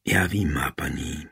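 low, soft reply; quietly. A narrator's reading. Man answering his dying queen through tears, quietly. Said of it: "řekl tiše".

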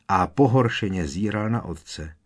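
ordinary delivery

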